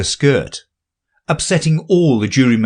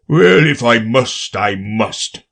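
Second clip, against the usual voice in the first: melancholy; slower than usual. A narrator's reading. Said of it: "with a melancholy air".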